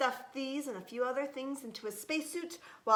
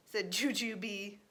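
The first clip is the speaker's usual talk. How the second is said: ordinary delivery, nothing marked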